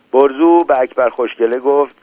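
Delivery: usual speaking voice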